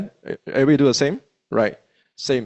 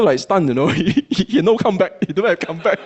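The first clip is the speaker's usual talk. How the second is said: normal talking voice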